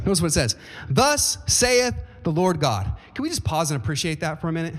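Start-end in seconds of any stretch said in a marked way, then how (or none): 0.9-2.9 slow, weighty, every word drawn out; solemn proclamation. Acted. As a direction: proclaim it like a prophet.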